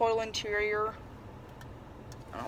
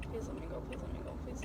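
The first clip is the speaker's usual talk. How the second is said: anxious whispered plea; 13 dB quieter, much faster than usual. Real, not acted. Pleading with traffic while driving.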